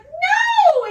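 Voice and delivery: put-on very high, loud child's cry; mock tearful protest. Mother imitating son rejecting the joke, mock tearful yell. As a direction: imitate an upset little boy refusing. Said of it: "tearing up".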